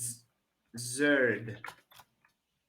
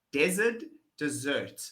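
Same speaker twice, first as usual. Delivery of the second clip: usual speaking voice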